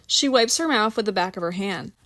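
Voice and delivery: usual speaking voice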